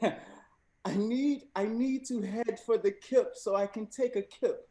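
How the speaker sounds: a host gamely trying British English; British accent. Shown as a demonstration. Host reading the slang sentence to contestants in a mild British accent. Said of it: "I will be attempting to read the sentence in a British accent".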